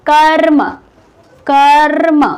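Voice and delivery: कर्म with a drawn-out rolled र before म; demonstrated pronunciation. Shown as a demonstration. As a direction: stretch the r in कर्म, karr-ma. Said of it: "अब देखिए, इसको उच्चारण कैसे करें". (कर्म में र को खींचकर, फिर म बोलें)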